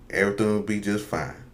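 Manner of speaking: ordinary talk, nothing marked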